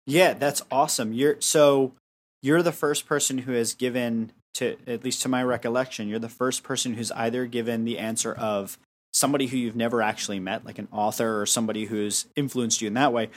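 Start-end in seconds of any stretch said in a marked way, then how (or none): none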